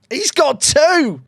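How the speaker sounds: slow, drawn-out delight. Real, not acted. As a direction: savour the second goal. Slow delighted goal reaction.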